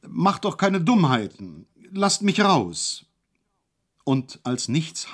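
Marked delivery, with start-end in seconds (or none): 0.0-3.0 high, slow, pleading call. A narrator's reading. Hejula calls from isolation to be let out, high and slow. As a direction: call through the door to be let out. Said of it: "rief er".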